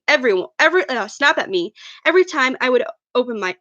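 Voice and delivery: plain reading voice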